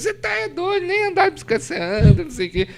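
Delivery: high-pitched mock scolding, imitating someone; imitated "are you crazy?" squawk; incredulous teasing retort. Imitating wife's scoffing retort.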